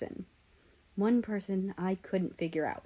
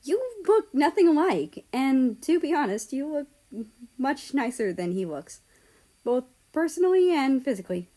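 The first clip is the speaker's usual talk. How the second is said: higher and louder, stunned; disbelief; 6 semitones higher, 5 dB louder than usual. Acted. America doubting Ukraine and Russia are related, disbelieving. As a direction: press it out in disbelief. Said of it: "I said in disbelief".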